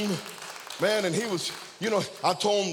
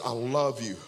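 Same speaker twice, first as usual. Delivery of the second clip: tender; 7 semitones lower, 3 dB quieter than usual. Retold or imitated. Pastor holds the crying young man's face, tender and soft. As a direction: say it gently, close up. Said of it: "tender words at the altar".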